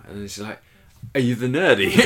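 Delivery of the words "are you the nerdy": fan voice. Retold or imitated. Imitated fan, loud.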